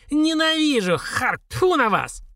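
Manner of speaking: high drawn-out cry of hatred, ending in a spit; hateful old woman. A narrator's reading. Old woman cursing the rivals who took the onions, hateful. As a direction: curse them high and slow, then spit.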